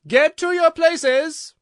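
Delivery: loud, high, drawn-out command; slow, booming imperious shout; voice of thunder. A narrator's reading. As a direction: thunder the order as a Queen. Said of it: "in a voice of thunder".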